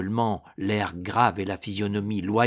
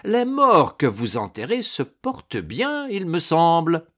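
higher, lively taunt; mocking; 6 semitones higher, 5 dB louder than usual. A narrator's reading. Supposedly dead knight taunts the baron, mocking. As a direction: rub it in with cheerful mockery. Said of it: "railla Ragastens".

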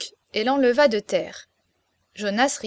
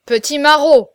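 loud, higher jealous snap; angry outburst; 4 semitones higher, 11 dB louder than usual. A narrator's reading. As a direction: spit the insult out loud. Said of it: "s'écria-t-il".

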